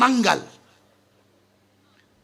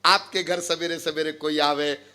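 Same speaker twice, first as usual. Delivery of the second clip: normal talking voice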